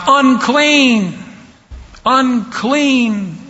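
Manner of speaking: high, drawn-out cry of a leper; acted shout. Leper warning the clean as they approach, high mock shout. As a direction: call out the warning, each word stretched and pitched high. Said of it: "you would be required to shout".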